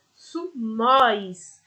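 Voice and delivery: sumóis said slowly, stressing the ó; slow model plural. Shown as a demonstration. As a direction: spell out sumóis syllable by syllable, leaning on the open ó. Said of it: "acrescentamos um acento agudo na vogal O".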